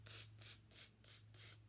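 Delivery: quick voiceless "tss" clicks of mimicking monkeys; cheeky monkey tsking; monkey chatter tsks. A narrator's reading. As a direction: chitter "tss" softly, evenly, without voice. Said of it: "and said".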